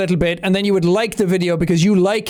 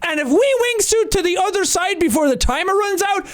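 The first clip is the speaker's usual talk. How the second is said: high, bright-eyed intro voice, much higher; hyped youtuber intro; 11 semitones higher than usual. Retold or imitated. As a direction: pitch it way up like an eager young youtuber.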